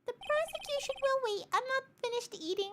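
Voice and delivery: squeaky high judge voice; high, fussy judge; high-pitched judge. Acted.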